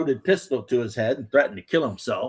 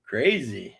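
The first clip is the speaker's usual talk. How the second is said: ordinary talk, nothing marked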